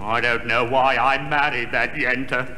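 very low monotone grumble; grumbling to himself. Acted. Henpecked monster regretting his marriage, grumbling low. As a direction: complain under your breath, deep and droning.

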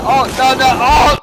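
high loud panicked yell; cry out. Real, not acted. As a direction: yell out in alarm. Crash in game, cry out.

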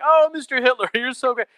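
high, loud, gushing mock flattery; fawning judge. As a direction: gush over Hitler like a smitten fan. Judge fawning over Hitler.

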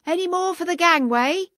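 high, loud call across the deck; shouted call. Acted. Sailor calling the last passengers to board, shouted. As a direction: cry it out to the whole quay. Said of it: "a voice like a cry shouted".

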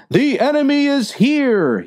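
high alarmed yell of danger. Acted. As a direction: cry out that the enemy has come. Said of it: "yelled the other man".

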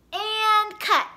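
high loud shout of cut; loud call. A narrator's reading. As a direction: call cut across the set. Director ends scene.

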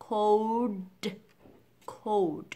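"cold" with a dark L before D; correct cold. Shown as a demonstration. Teacher modelling the fix, "cold" with clear dark L. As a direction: say "cold" slowly, tongue up for L. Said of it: "Hất lưỡi lên làm âm L".

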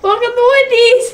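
high, loud greeting through laughter; laughing. Real, not acted. Cracking up while greeting a friend, laughing. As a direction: blurt it out mid-giggle fit.

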